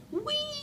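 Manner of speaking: high gliding whee; roller coaster whee. Shown as a demonstration. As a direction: sound sweeps up like a ride. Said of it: "I say like a roller coaster".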